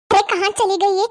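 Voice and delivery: ordinary talk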